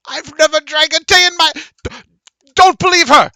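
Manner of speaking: high, loud outraged yelling; a merchant shrieking his denial; shouted protest. Acted. Merchant shrieks at the knight in outrage.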